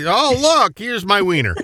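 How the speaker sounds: high, gleeful mock reveal; mock-delighted announcement. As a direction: act out a proud little show-and-tell. Mimics the man exposing himself.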